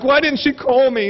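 high, mildly hurt complaint; mock upset. Lady feels left out by pregnant star, mock upset. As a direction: imitate a slighted friend. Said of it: "she, like, sounded upset".

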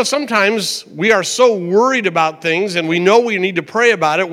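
ordinary delivery

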